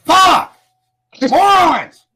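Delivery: real rage blowing up at the others; furious shout. Real, not acted. Someone's mic ruins the stream.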